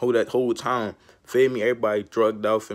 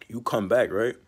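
ordinary delivery

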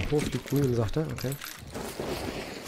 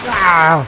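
pained shout; 3 semitones higher, 10 dB louder than usual. Real, not acted. Hit in the shooter game.

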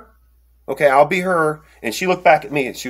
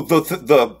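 ordinary delivery

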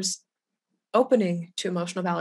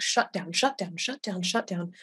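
repeated shut down; 2 semitones lower than usual. Retold or imitated. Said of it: "which all feel to me like".

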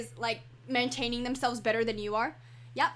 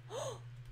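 soft gasp; 10 semitones higher, 12 dB quieter than usual. Real, not acted. Reacting to something in game.

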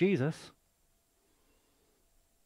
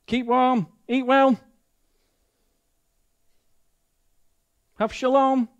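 mock hollow blessing; 9 semitones higher, 8 dB louder than usual. Mocking an empty blessing.

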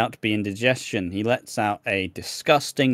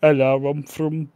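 put-on Birmingham accent; Brummie accent; 2 semitones higher than usual. Podcaster showing off absolute Brummie to co-host, Birmingham accent. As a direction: say it in thick Brummie. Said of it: "Just absolute brummie".

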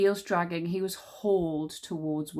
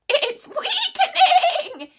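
shrill, very high, slow gloating screech; King Witch screeching with a cackle; 11 dB louder, much slower than usual. A narrator's reading. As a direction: screech in triumph like a monster. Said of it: "screeched the King Witch".

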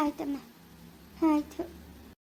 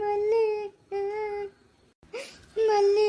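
higher, crying out a name; tearful call; 4 semitones higher, much slower than usual. Acted. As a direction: call her name through tears. Calling Malli, crying.

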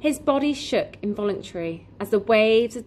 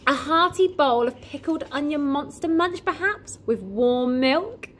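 higher, coaxing shopkeeper; kindly shopkeeper voice; 7 semitones higher than usual. Acted. Raj offers breakfast.

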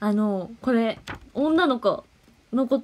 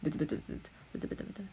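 very low, hushed mumbling; playful barely audible muttering to oneself; 6 semitones lower, 14 dB quieter, much faster than usual. Pretend. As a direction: mutter under your breath. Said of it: "これブツブツ言ってます".

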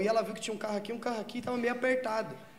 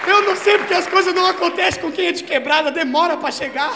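much louder, higher frustrated shouting; mock furious rant; 10 semitones higher, 15 dB louder, slower than usual. [name] ranting at her about the drug, furious shout. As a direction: yell in outrage that the high never comes. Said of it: "E eu puto".

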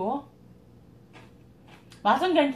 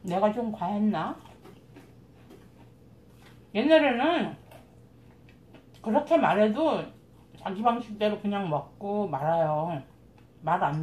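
words around a mouthful; speaking between chews; talking while chewing. Real, not acted. Seen 2: Bite at -7; cheeks bulge, lips working while talking throughout.

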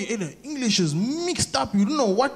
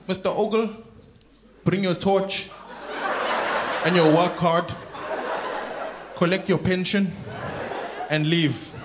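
mock Survivor host; 4 semitones lower, 4 dB quieter than usual. Host sends a voted-off worker home, mock solemn. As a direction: pronounce the verdict piece by piece, solemnly.

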